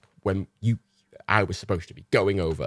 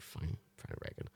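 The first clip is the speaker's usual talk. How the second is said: resigned mutter; 5 semitones lower, 19 dB quieter than usual. Retold or imitated. Grudgingly giving in to dog.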